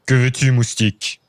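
lightly voiced scornful giant; a hint of a booming giant; big giant voice. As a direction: giant looking down at a tiny girl, mildly scornful. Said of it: "Une grosse voix retentit".

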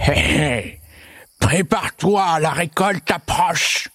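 slow, high, taunting monster voice; snigger, then draw out a gleeful warning; mocking monster with an evil chuckle. A narrator's reading. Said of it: "le monstre surgissait en ricanant".

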